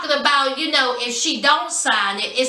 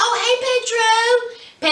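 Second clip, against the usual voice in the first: high sweet mom greeting; 10 semitones higher, slower than usual. Retold or imitated. Mom greets Pedro on speaker.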